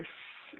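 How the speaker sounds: soft whispered shush; hushing shh. Retold or imitated. Dad joining in hushing the room, soft shush. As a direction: quietly hush along with him. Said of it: "I'm like".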